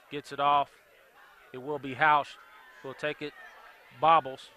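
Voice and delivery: usual speaking voice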